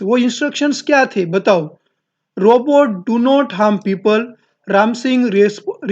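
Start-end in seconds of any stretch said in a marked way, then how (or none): none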